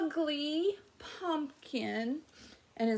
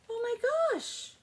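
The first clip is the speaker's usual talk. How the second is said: surprised gasp; 12 semitones higher than usual. Acted. Surprised discovery in story.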